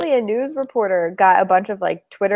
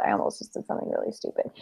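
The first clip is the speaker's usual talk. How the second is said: whisper; 12 dB quieter, faster than usual. Real, not acted. Catching herself mid-sentence.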